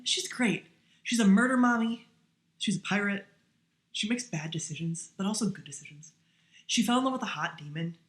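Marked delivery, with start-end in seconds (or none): 0.0-3.2 louder, higher gushing praise; a fan gushing about her favorite pirate; excited gush. Real, not acted. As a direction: hype her up with glee. Gushing over favorite character.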